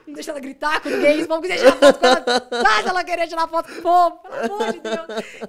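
loud, laughing, pleading prayer; frantic mock prayer. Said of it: "E eu orando". (oração desesperada, alta e rindo)

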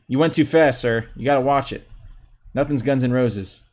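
ordinary talk, nothing marked

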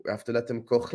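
usual speaking voice

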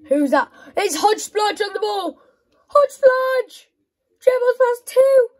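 loud, high commentator shout; mock commentary. Gamer commentating his own player, loud and high. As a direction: call it like a live match commentator.

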